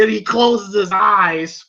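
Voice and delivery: plain reading voice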